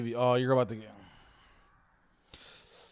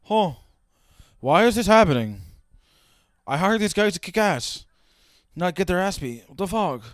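loud, high-pitched baffled boss act; incredulous imitation of someone confused; mock confusion; 4 semitones higher, 7 dB louder than usual. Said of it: "he looks confused and shit".

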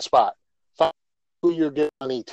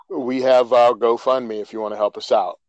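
ordinary talk

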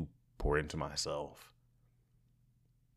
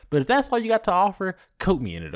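loud sassy demand; 13 semitones higher, 10 dB louder, much faster than usual. Real, not acted.

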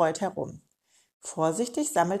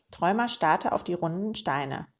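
ordinary delivery

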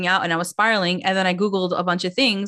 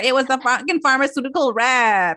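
mock indignant correction, pitched higher; Jen; 4 semitones higher than usual. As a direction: act out an exasperated comeback in a raised voice. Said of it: "and then Jen's like".

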